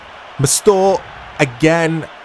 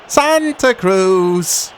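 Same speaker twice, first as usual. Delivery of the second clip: excited; 4 semitones higher, much slower than usual. Real, not acted. Praising scorer after penalty.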